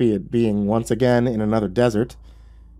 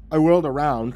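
ordinary delivery, nothing marked

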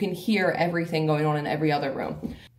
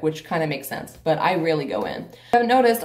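ordinary delivery

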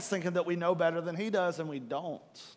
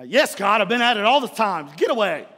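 annoyed brush-off; 2 semitones higher, 7 dB louder than usual. Retold or imitated. Brushing off God in retold scene.

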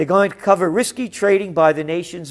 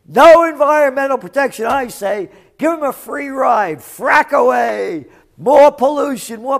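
loud, higher mocking cheer; sarcastic mock cheer; 5 semitones higher, 5 dB louder than usual. Real, not acted. Mock cheer for EPA cuts.

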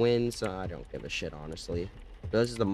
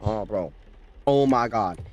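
usual speaking voice

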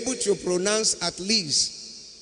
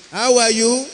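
How are you asked loudly, HOW stressed and high; prompting question; 6 semitones higher, 7 dB louder than usual. Shown as a demonstration. Pastor cueing the boy to answer, loud clear prompt. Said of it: "When I say, "How are you?".

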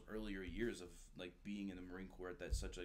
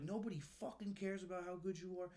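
angry yelling; 10 semitones higher than usual. Retold or imitated. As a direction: tear into him like a furious gunny. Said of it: "He's like".

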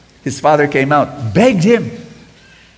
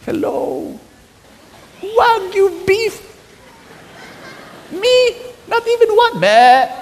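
very high, slow, incredulous; mock outrage; slower than usual. Preacher hamming up the jealous brother's outrage, incredulous. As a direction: squeal it in disbelief.

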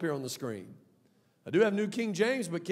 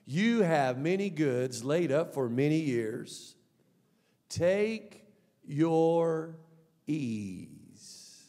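slow, self-satisfied reading; 2 semitones lower, much slower than usual. Acted. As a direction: stretch each phrase out slowly. Rich man soothing his soul.

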